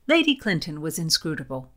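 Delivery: usual speaking voice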